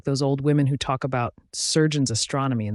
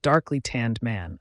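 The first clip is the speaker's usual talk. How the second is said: usual speaking voice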